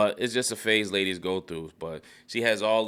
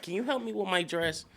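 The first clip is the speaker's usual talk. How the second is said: lady's request; 9 semitones higher, 4 dB quieter than usual. Retold or imitated. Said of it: "she was just like".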